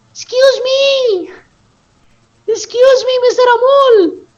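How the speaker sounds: high, slow, sweet lady calling out; sweet lady's voice. Retold or imitated. Ladies calling after Amol in the mall, in a sweet high voice. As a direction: call sweetly in a high falsetto. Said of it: "he heard some beautiful voice from behind".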